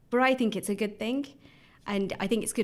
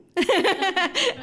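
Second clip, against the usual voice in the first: a high, loud burst of laughter; 13 semitones higher, 7 dB louder than usual. Real, not acted. Laughing loud at her own Birmingham answer. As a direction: laugh out at your own punchline.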